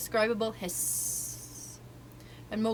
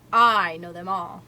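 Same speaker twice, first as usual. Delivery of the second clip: showing off; 2 semitones lower, 9 dB louder, slower than usual. A narrator's reading. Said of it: "delighted to show off".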